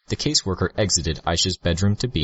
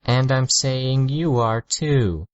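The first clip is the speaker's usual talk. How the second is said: condescending, drawn out and higher; slow, talking down; 8 semitones higher than usual. A narrator's reading. Girl talks down to the narrator, slow and condescending. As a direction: spell it out as if to a slow child. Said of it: "slowly, like she was talking to someone".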